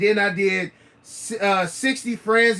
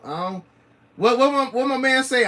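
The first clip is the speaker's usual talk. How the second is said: normal talking voice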